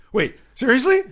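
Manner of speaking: squeaky high, loud disbelief; can't believe your luck; a shocked, thrilled yelp at good news; stunned delight. Retold or imitated. Told he got the part.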